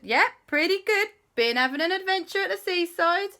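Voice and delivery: put-on high, loud cheerful children; high kid voices. Kids telling JB about the seaside, high and loud. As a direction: chirp it like excited kids.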